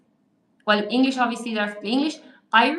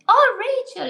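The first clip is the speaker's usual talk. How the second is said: much higher and louder, thrilled to see her; light up at a familiar name; delighted greeting; 11 semitones higher, 6 dB louder than usual. Real, not acted. Greeting a viewer with surprise.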